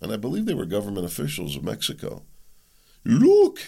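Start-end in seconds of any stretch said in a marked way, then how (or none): 3.0-3.6 high, loud mock Mexican accent; poor Mexican accent. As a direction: blurt it out in a hammed-up Mexican accent. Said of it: "That was a poor Mexican accent".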